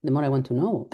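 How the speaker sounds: ordinary delivery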